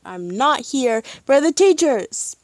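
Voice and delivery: high, loud hammed-up refusal; mock protest. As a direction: object with exaggerated emphasis. Mock protest against the romance.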